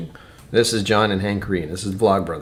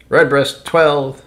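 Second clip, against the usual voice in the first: loud, high, drawn-out presentation; grand announcement; 6 semitones higher, 7 dB louder, much slower than usual. Acted. Host presenting a favorite of his father's, slow grand announcement. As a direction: announce it slowly and proudly. Presents the next whiskey on list.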